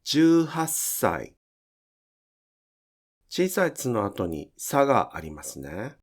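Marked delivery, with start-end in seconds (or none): 0.0-1.4 十八歳 said slowly with the small つ held as a long s; higher, careful teacher model of 十八歳; slow model word. Shown as a demonstration. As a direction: stretch the hiss in 十八歳. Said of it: "をずっと発音してください".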